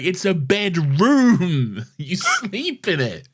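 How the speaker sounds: high, drawn-out mock exasperation; exasperated Clara. Fan voices Clara explaining a bedroom to the Doctor, mock exasperation. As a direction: spell out the obvious, loudly and slowly. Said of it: "And Clara's like".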